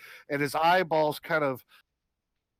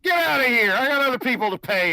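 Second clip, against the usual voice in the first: loud, high, fast brush-off; angry dismissal; 7 semitones higher, 7 dB louder, faster than usual. Acted. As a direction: snap at him to get lost.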